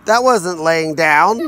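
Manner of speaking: slow words through real laughter; laughing. Real, not acted. Dad laughing at the dog's failed trick. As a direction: laugh while teasing the dog.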